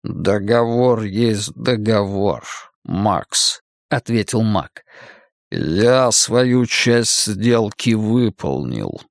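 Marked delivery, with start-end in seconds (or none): 0.0-3.6 sinister magician. Acted. Cain states the pact. 5.5-9.0 sinister magician. Acted. Cain states the pact.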